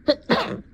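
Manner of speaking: sudden loud hacking cough. Acted. Allergic fit starting.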